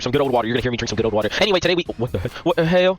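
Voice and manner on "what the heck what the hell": startled outburst, pitch jumping; surprised. Real, not acted. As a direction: blurt it out in shock. Sudden surprise at screen.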